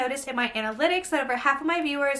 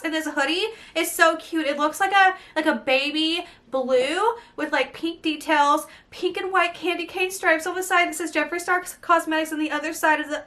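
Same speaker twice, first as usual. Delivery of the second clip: ordinary delivery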